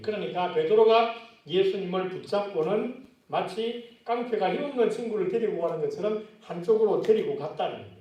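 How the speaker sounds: usual speaking voice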